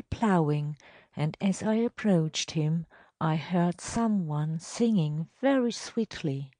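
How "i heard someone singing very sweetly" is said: plain reading voice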